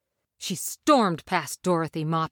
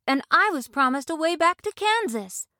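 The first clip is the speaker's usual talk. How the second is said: Dorothy voice; 9 semitones higher than usual. A narrator's reading. Dorothy speaks.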